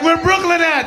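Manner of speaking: high-pitched street holler; old Brooklyn call-out. Acted. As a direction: call it out high to the whole block. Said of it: "this how Brooklyn used to sound".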